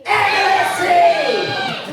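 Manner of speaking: very high, drawn-out yell; group rallying shout. Pretend. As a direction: yell it slowly with the whole class. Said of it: "we're all going to say".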